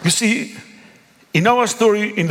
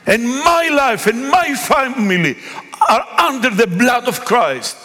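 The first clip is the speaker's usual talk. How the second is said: bold rebuke; 7 semitones higher than usual. Retold or imitated. Pastor rebuking a cursing woman, bold and raised. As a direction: raise the voice in spiritual authority.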